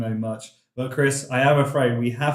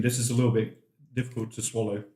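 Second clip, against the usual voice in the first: talking through a hot mouthful; words round burning food; mouth full of hot food; 4 semitones lower, 5 dB quieter, faster than usual. Real, not acted. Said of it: "My mouth is on fire".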